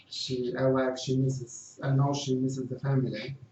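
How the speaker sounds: ordinary delivery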